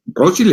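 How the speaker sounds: Rothschild said the German way, ending in "-schild" with a short i; German pronunciation. Shown as a demonstration. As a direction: say Rothschild as "ROT-shild". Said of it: "en alemán".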